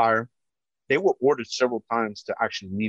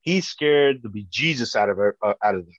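ordinary talk, nothing marked

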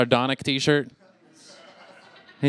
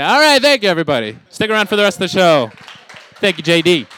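loud sign-off; 3 semitones higher, 7 dB louder, faster than usual. Real, not acted. Comic signs off loudly.

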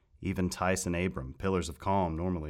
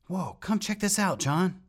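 put-on excited boyish shout, much higher; 7 dB louder than usual. A narrator's reading. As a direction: call out thrilled and loud. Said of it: "shouted Rat".

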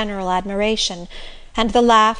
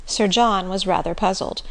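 ordinary delivery

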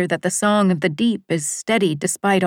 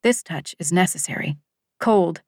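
ordinary delivery

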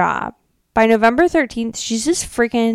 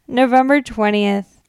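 usual speaking voice

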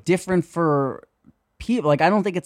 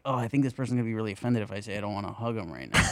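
anxious rushed murmur; 6 semitones lower, 9 dB quieter, faster than usual. Retold or imitated. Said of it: "instead of having to be like".

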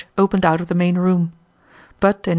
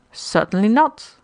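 mild nonchalance; 2 semitones higher, slower than usual. A narrator's reading. Rocco feigns indifference.

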